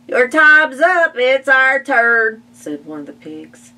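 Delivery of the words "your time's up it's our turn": high, loud demanding pig voice; pushy pig. Acted. Pig demanding the bathtub from Ma, loud and high. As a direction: bark it like a rude pig. Pigs barge in for bath.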